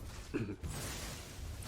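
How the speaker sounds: rough throat clear. Real, not acted. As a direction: clear your throat hard. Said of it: "coughing a little bit".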